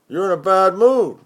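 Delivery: a different man teasing; roomie's voice. Acted.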